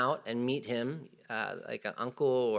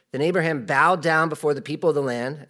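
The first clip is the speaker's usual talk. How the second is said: raised, louder Bible reading voice; scripture reading; 3 semitones higher, 7 dB louder than usual. Acted. Preacher reads scripture aloud to church, louder. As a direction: read the verse out to the congregation.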